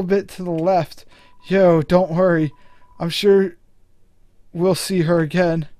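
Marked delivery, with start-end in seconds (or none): none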